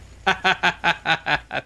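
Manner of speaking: loud burst of relieved laughter. Real, not acted.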